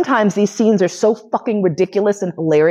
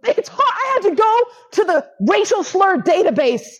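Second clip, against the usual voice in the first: much higher, squeaking through laughter; laughing retelling. Real, not acted. Retelling Steve's excuse, high and helpless with laughter. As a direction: quote him while you can't stop laughing.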